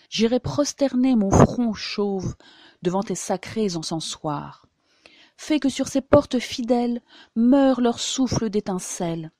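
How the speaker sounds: loud, urgent dramatic recital; impassioned poetry declamation. Shown as a demonstration. Reciter as someone besieged by a swarm of djinns, loud and impassioned. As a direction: declaim it forcefully, as if under siege.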